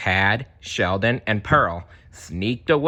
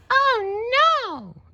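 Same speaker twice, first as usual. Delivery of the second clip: high gasping oh no; dismayed. Acted. Marlin seeing Nemo taken away. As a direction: cry it out in shock.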